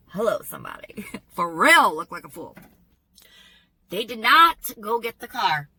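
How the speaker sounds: usual speaking voice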